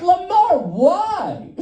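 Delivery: high-pitched, pleading disbelief, played for laughs; mock incredulous. Comic asking his Uber driver for a reason, mock incredulous. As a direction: protest to the driver in exaggerated bafflement.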